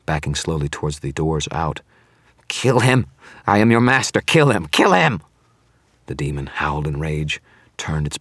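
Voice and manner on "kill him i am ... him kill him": shouted orders, higher and louder; frantic command. Acted. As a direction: scream at the demon to obey. Commanding a demon to kill.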